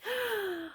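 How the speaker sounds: gasp. Retold or imitated. Husband finds the right heads.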